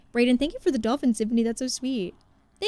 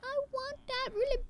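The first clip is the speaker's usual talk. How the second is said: longing whine; 10 dB quieter than usual. Real, not acted. Craving a game item.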